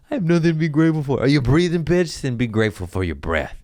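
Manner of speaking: high, loud, fast comic outburst; mock scolding. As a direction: fire it off as a hammed-up telling-off. Acts out complaint and scolding retort.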